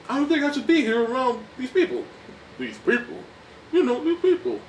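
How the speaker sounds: high, put-on Paulie voice; whiny, affronted drunk; Paulie impression. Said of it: "making racist comments".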